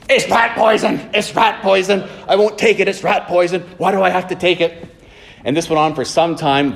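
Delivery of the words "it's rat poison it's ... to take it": imitating an agitated patient, pitched up high; mock yelling. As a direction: act out a stubborn patient yelling in protest. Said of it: "and the actor was yelling".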